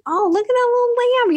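put-on high, childlike coo at the lamb; little girl voice. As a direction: sound like a ten-year-old melting over a lamb. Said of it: "And I was thinking".

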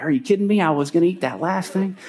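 my brownie, are you serious; exasperated disbelief. Retold or imitated. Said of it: "I'm like".

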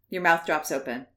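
usual speaking voice

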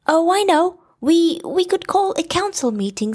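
high, fast, eager stammer; Piglet voice. A narrator's reading. Piglet suggesting a council meeting, high and eager. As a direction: blurt it out high and quick. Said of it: "suggested Piglet".

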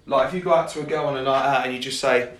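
usual speaking voice

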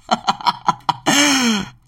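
high burst of rapid laughter; staccato laugh. Real, not acted. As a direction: laugh it out in quick pulses. Laughs after his own remark.

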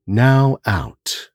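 slow, calm breathing guide counting aloud; guided breathing. Acted. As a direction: ease the listener in, counting breaths. Leads a breathing exercise.

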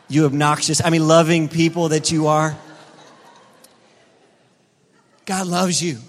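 rising to a loud preacher's climax; full-force preaching. Real, not acted. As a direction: preach it, then lean on every "loves you".

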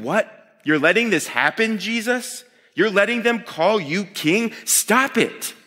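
high-pitched, incredulous Pharisee protest; mock indignation. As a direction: object in disbelief, acting out the scandalized critic. Acting out objecting Pharisees.

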